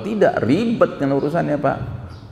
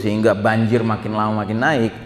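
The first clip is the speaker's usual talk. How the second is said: ordinary talk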